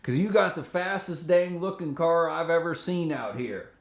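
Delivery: imitating an Arkansas cop in a Southern accent. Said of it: "I'm gonna say this in a Southern accent".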